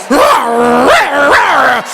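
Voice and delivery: mock roar. Imitating giants making noise.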